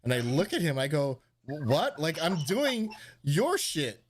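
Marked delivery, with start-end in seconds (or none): 1.5-4.0 baffled. Retold or imitated. Confused reply to Lee's question.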